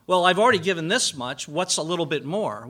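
mock gambler rationalizing; gambler's excuse. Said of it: "like the gambler".

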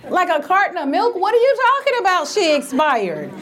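high, fast, outraged questioning; indignant outburst. Retold or imitated. Grieving granddaughter snapping back at the nurse, outraged. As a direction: fire it back in disbelief.

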